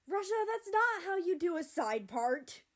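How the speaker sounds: loud, high yell over the hairdo. A narrator's reading. Kazakhstan yelling at Russia about his hair, loud. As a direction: shout it, fed up. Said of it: "Kazakhstan yelled".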